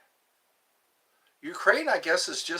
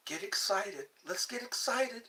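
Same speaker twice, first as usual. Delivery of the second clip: mock excited; 5 semitones higher, 6 dB quieter than usual. Real, not acted. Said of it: "urging excitement".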